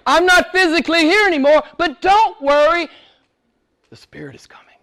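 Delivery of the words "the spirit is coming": hushed whisper, almost no voice. Acted. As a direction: lean in and whisper the promise. Whispered after "but don't worry.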